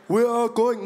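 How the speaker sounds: emphatic speechmaking impression; Julius Malema. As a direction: impersonate a rallying party leader. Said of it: "but the same voice".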